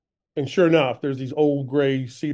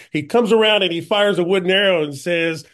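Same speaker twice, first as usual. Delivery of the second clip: ordinary talk, nothing marked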